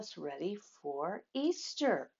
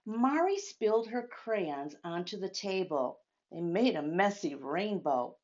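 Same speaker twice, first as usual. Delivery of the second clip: story narration; 4 semitones lower than usual. A narrator's reading. Reading picture book aloud.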